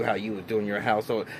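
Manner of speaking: ordinary talk, nothing marked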